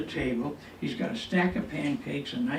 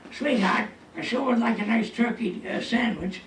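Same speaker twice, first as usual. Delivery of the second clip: higher, raised calling voice; acted-out holler, pitched up; retold yell upstairs; 7 semitones higher, 4 dB louder than usual. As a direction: call up to your sweetheart on another floor. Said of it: "So I yell upstairs".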